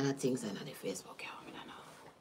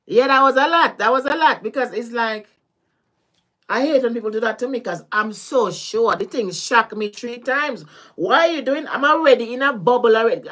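loud, higher-pitched protest; agitated venting; 5 semitones higher, 7 dB louder than usual. Real, not acted. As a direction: vent loudly, worked up about what was done to you. Upset recounting being shocked by someone.

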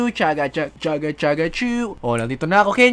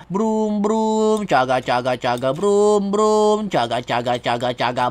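car and train noises; 4 semitones lower, 4 dB quieter, slower than usual. Pretend. Kids pretend to drive a cart.

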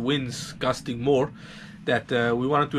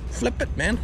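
higher-pitched, insistent repeat; jokey, pitched-up nagging command; mock impatience; 9 semitones higher than usual. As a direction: playfully insist on being obeyed. Repeats own instruction in mock impatience.

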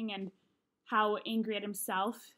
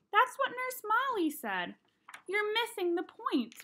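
exasperated; 10 semitones higher than usual. A narrator's reading. Mom exasperated at son.

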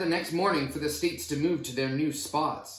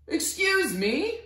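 much higher, slow polite interruption; politely; 14 semitones higher, slower than usual. Acted. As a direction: ask for attention sweetly. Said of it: "Idaho said politely".

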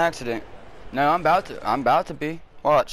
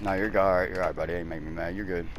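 lower, much softer, easy and kind; soft reassurance; 8 semitones lower, 6 dB quieter than usual. Real, not acted. Gamer calming a buddy who crashed into him, soft reassurance. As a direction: settle him down gently.

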